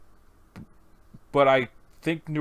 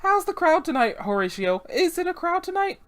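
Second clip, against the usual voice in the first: high, lilting character voice; inquiring club hostess. Acted. Boss asking Horatio about tonight's crowd, high and lilting. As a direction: sing out the question to the staff. Acted boss greets bar staff.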